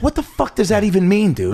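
high, exasperated disbelief; incredulous producer. Retold or imitated. Producer, baffled by the wig, asks what he means. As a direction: throw the question up high like you can't believe it. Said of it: "He goes".

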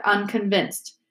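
ordinary delivery, nothing marked

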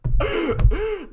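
loud high laugh burst. Real, not acted. Laughing at coach bit.

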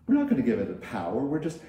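squeaky rising oh then quick fussy talk; lightly mimicked fussy group; mock protest. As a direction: play the congregation balking. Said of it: "It's like".

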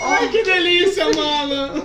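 mock delight.

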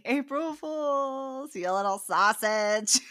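very slow, drawn-out mock gotcha; playful taunt. Co-host modelling a cover-up for next time, drawn-out playful taunt. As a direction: stretch it out as a teasing prank reveal.

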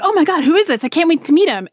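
high, eager voice for an excited dog. Acted. Owner voicing Grover meeting the new dog, high excited voice. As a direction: bubble over like a pet meeting someone new. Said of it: "Grover was all excited".

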